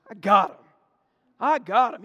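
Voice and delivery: loud triumphant I got him. Retold or imitated. A preacher retelling cornering the snake, calling out loudly. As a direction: call it out loud, pleased with the catch.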